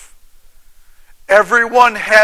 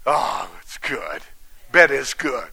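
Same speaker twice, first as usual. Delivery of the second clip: low, slow, drowsy contentment; lazy contented sigh; 8 semitones lower, 6 dB quieter than usual. Pretend. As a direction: sink into bed with a satisfied murmur. Preacher voicing contented sleeper.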